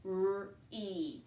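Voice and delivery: R-E ending in a long ee. Shown as a demonstration. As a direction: let the e say its name. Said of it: "The vowel is going to say its name".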